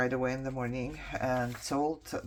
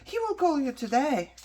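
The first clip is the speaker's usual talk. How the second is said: high, sing-song fake-polite voice; mocking receptionist; 4 dB louder than usual. Patient mocking the GI's receptionist, high and fake-sweet. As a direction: mimic a breezy receptionist brushing her off. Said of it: "And she's like".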